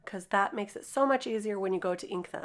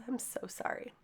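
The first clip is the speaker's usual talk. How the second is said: whispered apology; 3 semitones higher, 10 dB quieter than usual. Real, not acted. Apologizing for her raspy voice.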